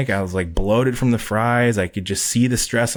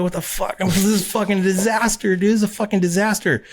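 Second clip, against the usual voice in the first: retold panicking; 10 semitones higher than usual. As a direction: act out your own frantic meltdown. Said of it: "I'm, like, fucking panicking".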